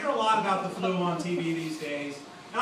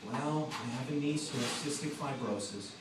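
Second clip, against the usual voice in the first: soft, low, pious sob-story voice; mock sincere chef; 7 semitones lower, 6 dB quieter than usual. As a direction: play the noble contestant, hushed and earnest. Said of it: "What are you gonna do?".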